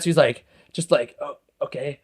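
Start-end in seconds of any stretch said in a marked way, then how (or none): 1.2-2.0 soft, much quieter retold reply; taken aback. Driver not expecting the apology, softly taken aback. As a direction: act quietly disarmed. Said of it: "totally wasn't expecting me to say that".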